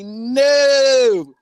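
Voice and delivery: loud, high no to a spammer; imitating himself turning down a spammer; acted refusal. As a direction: shut him down loudly.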